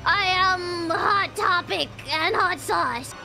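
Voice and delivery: ordinary talk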